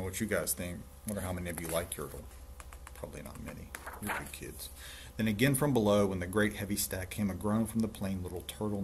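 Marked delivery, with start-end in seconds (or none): none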